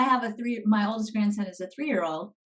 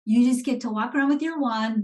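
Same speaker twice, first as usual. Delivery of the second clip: ordinary delivery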